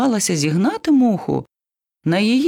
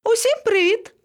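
very high sing-out greeting; high-pitched hello; cheery call. A narrator's reading. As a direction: hail everyone from up high. Monkey calls down from tree.

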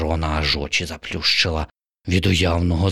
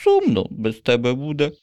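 a touch higher, gentle sad voice; sad sparrow; 8 semitones higher than usual. Acted. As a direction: sparrow saying he'll miss her.

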